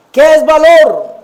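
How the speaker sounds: slow, loud, high call on valor; loud rhetorical question. Acted. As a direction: boom it to the room. Preacher poses key question loudly. (pregunta retórica lenta y en voz alta)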